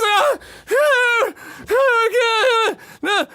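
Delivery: high, loud wails of pain; overdone screams over a small injury; wounded screaming. Acted. Wounded man keeps screaming.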